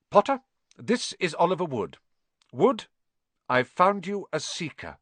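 high, crisp professor voice; proud teacher presenting a find; teacher introducing a new player; McGonagall voice. Acted.

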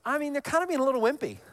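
quick, high scoffing aside; mocking the disciples. Preacher mocking the scared disciples as wimpy, high and quick. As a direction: brush them off as wimps, tongue in cheek. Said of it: "you're like".

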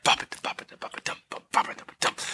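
soft percussive vocal drum beat; imitating ambient drums. Host describing the soundtrack to co-host, quietly imitating drums. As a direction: tap out a quiet drum rhythm with the mouth. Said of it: "kinda like ambient drums".

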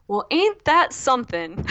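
put-on slow, drawn-out Southern accent; a light Southern drawl, imitating. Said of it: "something so Southern".

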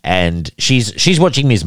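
ordinary talk, nothing marked

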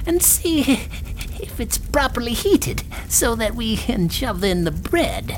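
high, drawn-out crone voice; witch. A narrator's reading. Witch lures Gretel to check the oven, crone voice. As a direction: coax her as a high-voiced old witch. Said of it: "said the witch".

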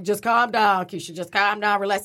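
low-pitched, soothing retelling of a husband; imitated calming husband. Husband telling his angry wife to relax, imitated low calming tone. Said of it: "my husband, of course, like".

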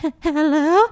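timid, high imitated stammer; mock-frightened, faltering greeting; terrified. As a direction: answer as if scared of who's there. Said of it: "first of all, terrified".

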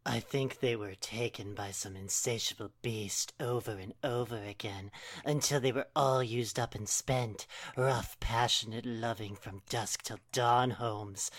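low seductive whisper; husky purr. Acted. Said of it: "let her voice drop into a husky purr".

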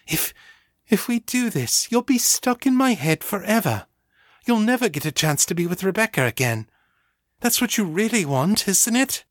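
convincing herself as much as him; desperate. A narrator's reading. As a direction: Ava desperately arguing. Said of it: "She sounded desperate".

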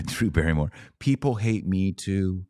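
ordinary talk

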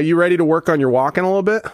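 usual speaking voice

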